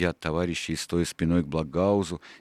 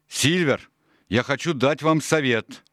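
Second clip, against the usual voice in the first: higher, louder call across the camp; 8 semitones higher, 4 dB louder than usual. Acted. Doctor calls Silver over from afar, calling out. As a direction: raise the voice to reach Silver. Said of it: "крикнул он".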